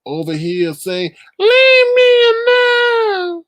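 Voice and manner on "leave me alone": high, loud, drawn-out fake wail; a hammed-up crying whine, very high and stretched; mock crying. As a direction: ham up a sobbing plea to be left alone. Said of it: "I don't want him crying".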